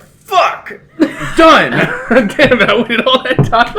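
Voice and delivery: high, loud yell of frustration; hammed-up outburst; mock frustrated yell. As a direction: shout it like the video just ended. Acted quote of frustrated friend.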